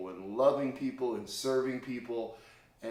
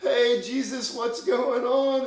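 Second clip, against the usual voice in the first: joyful shout; 13 semitones higher, 9 dB louder, slower than usual. Retold or imitated. Peter reaches Jesus joyfully.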